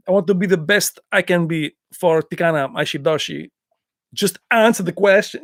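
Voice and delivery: put-on lightly French-accented Van Damme; Van Damme impression. Quoting Van Damme's interview for the viewers. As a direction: play the earnest action star. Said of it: "The way he said it is awesome".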